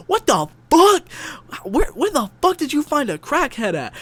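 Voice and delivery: high, loud stunned yell; shocked shout. Retold or imitated. Friends shouting at him about finding a crackhead. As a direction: scream it in total disbelief.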